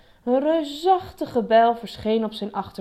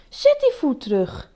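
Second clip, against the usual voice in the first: raised, loud command; stern order; 6 semitones higher, 5 dB louder than usual. A narrator's reading. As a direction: order them back crossly. Elephant orders himself back down.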